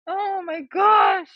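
high, drawn-out exasperated wail; exasperated groan. Real, not acted. Mom despairing of her thirteen-year-old, long high-pitched exasperation.

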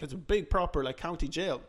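ordinary talk, nothing marked

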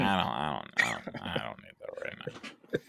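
hushed, drained mutter of refusal; weary refusal. Retold or imitated. Mock reaction to mean shows.

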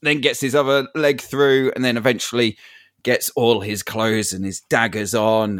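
usual speaking voice

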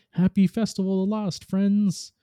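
high, cheerful greeting; pitched well up, merrily welcoming everyone; cheerful Glint. A narrator's reading. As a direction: chirp it out as a friendly little character. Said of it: "faced a cheerful Glint".

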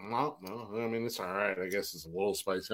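low, very soft, unfazed mumble; mock nonchalance. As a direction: shrug it off under your breath. Retold unfazed hot-sauce eater.

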